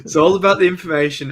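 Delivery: high, loud catchphrase delivery; mock showman shout. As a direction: belt it out like a showman.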